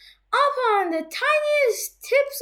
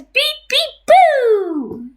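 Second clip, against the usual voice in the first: high beeps, then a drawn-out falling boo; lightly voiced horn ending in boo; Little Blue Truck; 4 semitones higher, 8 dB louder, much slower than usual. A narrator's reading. Narrator plays the truck as a ghost, playful beeps and boo. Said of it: "Little Chuck says".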